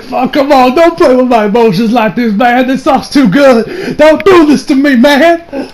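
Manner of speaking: high, worked-up mock protest; hammed-up emotional complaint; pained pleading. Acts own pained reaction to song.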